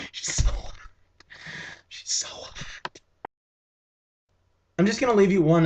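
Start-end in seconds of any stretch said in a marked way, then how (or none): 0.1-3.0 whispered, fading away. Real, not acted. Vlogger trailing off about an actress, whispering. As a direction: breathe it out to nothing.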